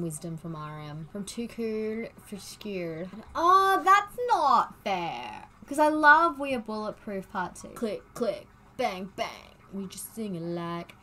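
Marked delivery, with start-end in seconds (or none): 3.3-7.7 whiny protest. Real, not acted. Torn between favourite songs.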